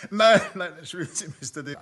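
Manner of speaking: laughing through the words. Acted. Temple laughs apologising to Davis. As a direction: still laughing while apologising. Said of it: "Hab ich etwas Komisches gesagt?".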